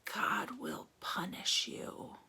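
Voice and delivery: slow whispered curse; old woman's whispered judgment; whispered threat. Acted. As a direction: hiss it slowly like a warning. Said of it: "and whispered".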